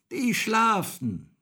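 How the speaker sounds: high, slow, loud rooster call. A narrator's reading. Rooster answers Häwelmann from the tower, calling down. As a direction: draw it out like a crow. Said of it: "rief der goldene Hahn hinunter".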